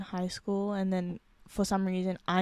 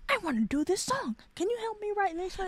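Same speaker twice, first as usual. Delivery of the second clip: eager pleading; 10 semitones higher, faster than usual. Retold or imitated. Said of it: "you were like".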